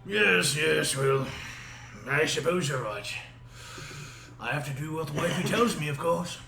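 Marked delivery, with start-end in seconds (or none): none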